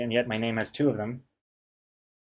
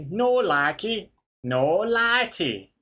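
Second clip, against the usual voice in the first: catchphrase voice; 7 semitones higher, much slower than usual. Retold or imitated. Quoting Take Me Out catchphrase.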